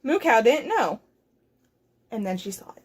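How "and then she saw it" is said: very soft, low narration; hushed suspense. A narrator's reading. Narrator as the lost cow spots something, hushed. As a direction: drop to a hush, building suspense. Suspense before seeing the moon.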